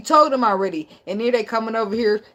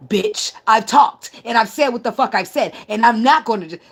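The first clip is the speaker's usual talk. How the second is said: louder indignant comeback; acted angry retort; 2 semitones higher, 4 dB louder than usual. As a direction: snap back at the shusher, emphatically. Acts angry reply to shusher.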